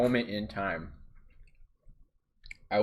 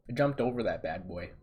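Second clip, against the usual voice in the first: normal talking voice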